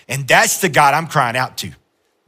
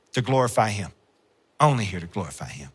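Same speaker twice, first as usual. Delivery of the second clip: hushed echo; 6 semitones lower, 7 dB quieter, faster than usual. Acted. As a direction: drop low and soft after the peak. Softened repeat after a high peak.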